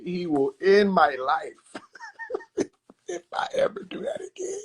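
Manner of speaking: laughing through it. Real, not acted. Laughing while retelling the threat.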